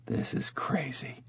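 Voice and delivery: breathless whisper, half to himself; a shaken doctor whispers in shock; stunned whisper. Acted. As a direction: whisper it in disbelief. Stunned doctor reads paternity report.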